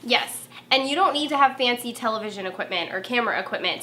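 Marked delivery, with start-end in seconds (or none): none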